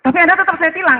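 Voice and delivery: polisi ngotot, tinggi dan keras; tiruan polisi ngotot. Acted. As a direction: putuskan tilang dengan suara lantang. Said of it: "kata polisi kan gitu ya". (memerankan polisi yang tak mau dibantah)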